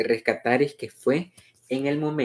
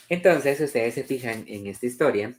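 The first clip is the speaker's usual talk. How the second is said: ordinary delivery, nothing marked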